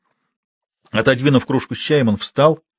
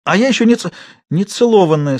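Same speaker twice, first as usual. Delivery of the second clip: high, loud, shaking words; trembling outburst; 8 semitones higher, 4 dB louder than usual. A narrator's reading. Girl shaking with anger, forcing out words at the man. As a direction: force it out through trembling. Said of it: "с усилием выговаривала Манька, вся трясясь".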